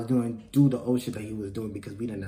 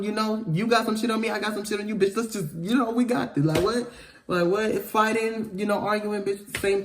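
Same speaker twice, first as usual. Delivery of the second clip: combative; 8 semitones higher than usual. Acted. Acts out spoiling for an argument.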